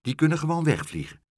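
ordinary delivery

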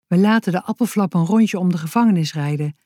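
ordinary delivery, nothing marked

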